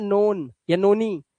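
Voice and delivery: नोन and नोनी with a long o, slowly; wrong readings. Shown as a demonstration. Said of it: "इसे नोन नहीं पढ़ना है". (नोन, नोनी में लंबा ओ)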